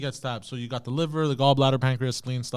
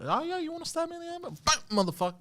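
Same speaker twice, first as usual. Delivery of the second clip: mock threat; 13 semitones higher, 6 dB quieter, faster than usual. Imagined revenge quote.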